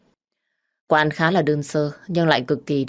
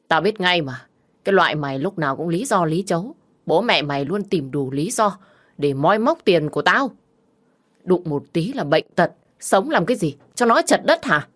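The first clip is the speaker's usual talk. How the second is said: angry shouting; 2 semitones higher than usual. Acted. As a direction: spit out insults, building to a yell. Drunk husband cursing in-laws.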